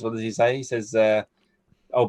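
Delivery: normal talking voice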